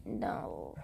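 low whispered no. Pretend. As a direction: breathe the no low and secret. Whispered rejection of the ship.

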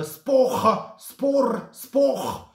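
high, varied tries at "Sport" as "spokh, spor, spokh"; trial pronunciations. Shown as a demonstration. Tipster hunts for the Swiss name, high-pitched "Sport" without its t. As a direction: swallow the t of "Sport", guttural or rolled ending. Trying out a foreign club name.

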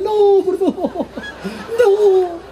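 high, very slow mock pleading scream; hammed-up panicked clothes fleeing the big head; terrified sweaters.

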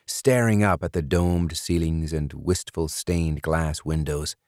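ordinary delivery

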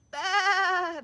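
high, loud bleat; lambs bleating. Acted. Lambs answering the little lamb, bleating. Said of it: "the lambs replied".

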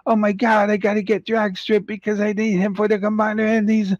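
high, fast, breathless mock fan gushing; imitating frantic fan. Mocking a collector desperate for Drag Strip, high frantic gush. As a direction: gush like an over-eager collector. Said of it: "it's like".

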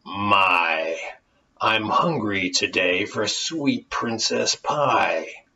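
low, slow hungry monster voice; growling fiend. Acted. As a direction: savor each word like a greedy beast. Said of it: "the fiend growled out".

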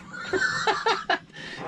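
airy burst of laughter; breathy laugh. Real, not acted. Husband laughing at his own courtship story. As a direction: laugh out without voice.

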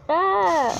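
high, loud yelp; startled scream. Real, not acted. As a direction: cry out as you slip. Startled while falling in game.

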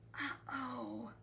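soft, high uh-oh; quiet dismay. A narrator's reading. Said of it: "he said again".

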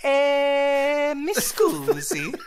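very high, drawn-out mock Italian; long high "eh", then slow Italian lilt; exaggerated Italian accent. Shown as a demonstration. Demonstrating the voice for Italy, exaggerated Italian accent. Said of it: "your voice goes up an octave".

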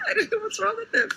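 high, laughing through tears; half laugh, half cry. Real, not acted. Emotional laugh at reveal.

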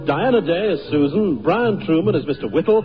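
ordinary talk

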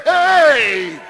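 long, loud falling "hey"; high, full-voiced holler; rallying shout. Shown as a demonstration. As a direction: shout it out for the crowd to copy. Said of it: "Somebody say".

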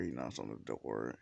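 ordinary delivery

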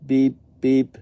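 two high, loud short beeps; tugboat beeps. A narrator's reading. What the tugboat says, high loud double beep. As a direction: toot twice like a tugboat. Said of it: "That's what the tugboat says".

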